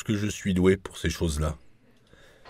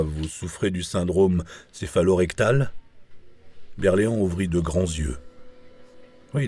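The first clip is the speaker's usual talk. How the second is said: plain reading voice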